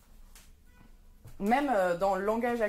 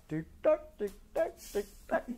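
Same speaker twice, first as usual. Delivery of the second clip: ticking clock; much slower than usual. Acted.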